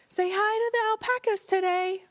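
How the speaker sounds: high, cheery put-on voice; imitating him. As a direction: chirp it like a sunny farm host. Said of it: "He's like".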